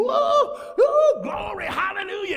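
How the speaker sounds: high, loud whooping cry of glory; soldiers yelling. Retold or imitated. Soldiers cheering the ark's arrival, loud whooping shout. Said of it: "soldiers started yelling and screaming".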